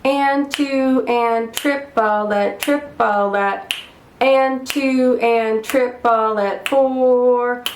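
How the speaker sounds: slow, measured rhythmic counting; slow practice count. Shown as a demonstration. Teacher practicing rhythms together with students, slow steady count. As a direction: count it out slowly, syllable by syllable. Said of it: "Let's try this on the slow side together".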